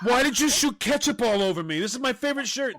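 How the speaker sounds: high-pitched, whiny protest; mock indignation. Ketchup victim upset over a favorite shirt, mock indignant. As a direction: complain in an exaggerated high voice.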